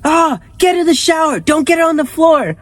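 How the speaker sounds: high, shouting mom; hammed-up panicked mom; mom yelling. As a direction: shriek orders like a frantic mom. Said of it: "All my mom did was like".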